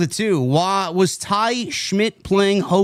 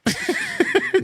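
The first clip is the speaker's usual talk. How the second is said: short squeaky laugh; high-pitched giggle. Real, not acted. Laughing at own joke.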